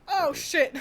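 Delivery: hiccuping laughing curse; hiccups. Real, not acted. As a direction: swear through a hiccup. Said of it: "I've got the hiccups".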